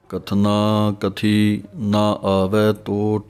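ਕਥਨਾ said joined as one word, slowly, pause after ਕਥੀ. Shown as a demonstration. Teacher demonstrates ਕਥਨਾ joined, slow recitation. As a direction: recite ਕਥਨਾ without splitting it. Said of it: "ਕਥਨਾ ਪਾਠ ਇਕੱਠਾ ਪੜ੍ਹਨਾ ਹੈ".

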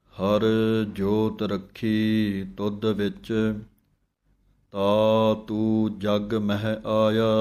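slow chanted reading of the hymn; scripture recitation. Shown as a demonstration. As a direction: recite the verse slowly and devoutly.

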